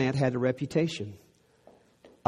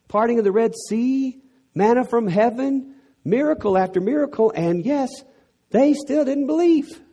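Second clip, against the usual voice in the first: high and loud, building; fervent sermon climax; preaching at full force; 10 semitones higher, 4 dB louder than usual. Acted. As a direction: pile up the miracles in rising fervor.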